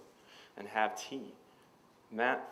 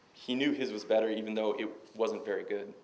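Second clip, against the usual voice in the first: ordinary delivery, nothing marked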